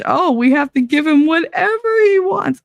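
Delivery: high, whiny mock parent voice; mock fretting parent. Mocking parents fretting over the golden boy, high and whiny. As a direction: ham up the doting mother.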